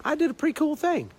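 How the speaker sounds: high-pitched, casual pat on the back; playful, modest little boast; acted chill self-praise. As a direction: toss it out high and easy, with pauses. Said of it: "chill for five minutes and say".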